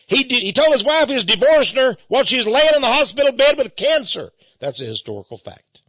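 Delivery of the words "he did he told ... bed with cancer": louder, higher, outraged telling; indignant outrage. Real, not acted. Host denouncing Gingrich to listeners, loud indignant outrage. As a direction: hammer it home in disgust.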